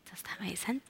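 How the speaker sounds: whispered aside. Real, not acted. Aside check with the congregation.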